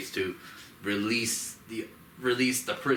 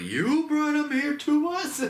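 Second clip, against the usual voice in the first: far higher, slow squeaky disbelief; incredulous squeak; 5 dB louder, slower than usual. Retold or imitated. The Scar King challenges the ape who brought Kong, high and incredulous. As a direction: squeak it up in outrage. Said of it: "he looks at him like".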